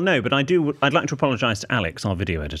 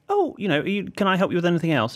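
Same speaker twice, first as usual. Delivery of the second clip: chirpy shop assistant; 7 semitones higher than usual. Retold or imitated. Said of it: "Tea counter woman sees me and she goes".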